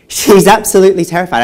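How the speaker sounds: loud, high burst on "absolutely terrified"; emphatic outburst. Acted. Emphatic escalation of the girl's terror.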